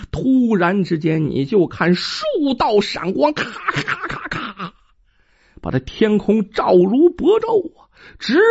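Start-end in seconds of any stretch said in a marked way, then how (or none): 3.3-4.7 imitated thunder crackle. Lightning strikes the mountain.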